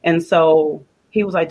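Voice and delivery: ordinary talk, nothing marked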